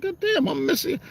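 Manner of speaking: high, soft mock regret; husband pretending to mourn what his wife won't do; mock lament. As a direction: sigh over the fun you're missing out on. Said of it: "I was like".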